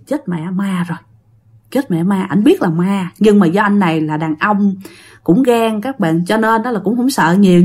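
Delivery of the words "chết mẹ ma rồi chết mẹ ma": softer, hushed, mildly frightened mutter; scared hushed thought. Retold or imitated. Husband spotting two ghosts beneath the bed, hushed mild fright. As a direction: hold it low, like a man afraid the ghosts will hear. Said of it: "anh mới suy nghĩ".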